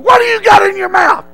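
high, hollered question; officer's angry demand. Retold or imitated. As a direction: yell it in the recruit's face. Officer confronts gum-chewing recruit.

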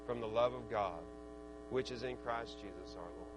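low, soft sermon delivery; hushed preaching. Real, not acted. Preacher assuring the congregation of God's love, hushed preaching. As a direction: bring the verse down to a quiet, low assurance.

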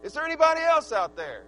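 loud disbelieving rant at God; exasperated outburst. Retold or imitated. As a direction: throw up your hands at yourself. Imagined exasperated self-talk.

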